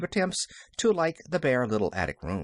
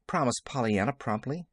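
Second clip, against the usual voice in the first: plain reading voice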